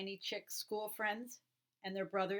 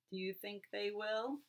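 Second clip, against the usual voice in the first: usual speaking voice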